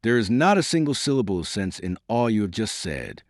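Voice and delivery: ordinary delivery, nothing marked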